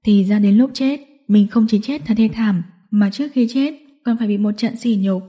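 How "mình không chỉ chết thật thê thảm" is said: ordinary delivery, nothing marked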